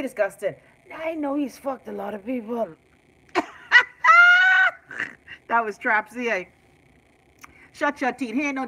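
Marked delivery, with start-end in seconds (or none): 3.3-5.4 high, loud shriek of laughter. Real, not acted. Big laugh.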